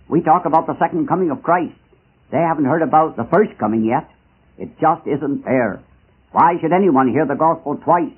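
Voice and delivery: ordinary talk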